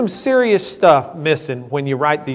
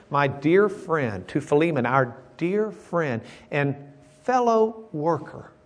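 slow, lingering reading; slower than usual. Shown as a demonstration. Pastor reading Paul's greeting to class, slow and lingering. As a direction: read it slowly, lingering on each word. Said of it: "read that a little bit louder and a little bit slower, just lingering on each word".

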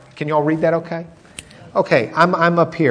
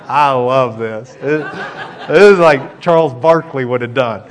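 laughing aside; 5 semitones lower than usual. Real, not acted. Preacher amused by Elijah's taunt.

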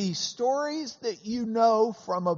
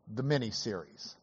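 whispered admission; 7 semitones lower, 8 dB quieter than usual. Retold or imitated. Answering Scalia at the table, dropping to a whisper. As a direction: drop to a whisper on the miniseries.

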